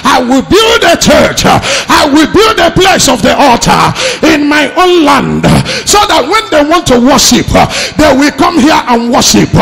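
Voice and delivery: loud, high-pitched sermon delivery; full-force preaching. Real, not acted. Preacher rebuking pastors through Jeroboam's story, full-force preaching. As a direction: preach it hard, pushing every phrase.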